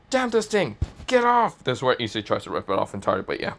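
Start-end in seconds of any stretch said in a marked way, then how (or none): 0.1-1.6 high, strained cry to get it off; angry yell. Acted. Issei tearing at the gauntlet, yelling high and angry. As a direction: yank and yell at it.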